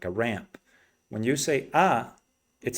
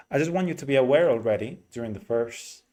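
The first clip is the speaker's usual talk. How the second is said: normal talking voice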